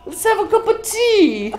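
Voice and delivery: very high falsetto posh Brit; mock British falsetto; mock British accent. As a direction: offer tea like a prim Brit.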